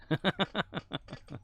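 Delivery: squeaky high laugh; short high-pitched giggle. Real, not acted. Laugh after misheard lyric.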